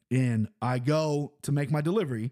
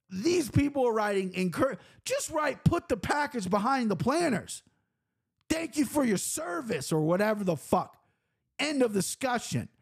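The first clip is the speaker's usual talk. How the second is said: exasperated rant; 8 semitones higher than usual. Real, not acted. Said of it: "set me off".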